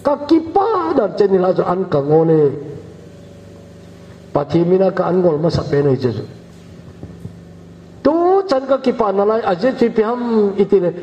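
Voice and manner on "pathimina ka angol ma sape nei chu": ordinary talk, nothing marked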